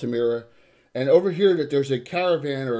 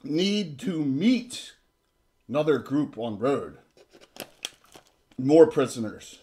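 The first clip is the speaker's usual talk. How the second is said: bugbear guard talk; slower than usual. Acted. Bugbear guard explains the road.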